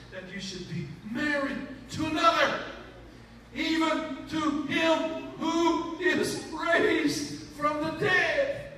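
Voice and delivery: slow, building to a shout; preacher booming scripture to the church; fervent scripture reading. Acted. Scripture read with rising fervor.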